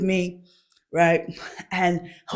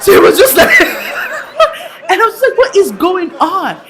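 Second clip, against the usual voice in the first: high, breathless laughing telling; laughing hard; 10 semitones higher, 5 dB louder than usual. Real, not acted. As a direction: crack up through the words. Laughing retelling of confusion.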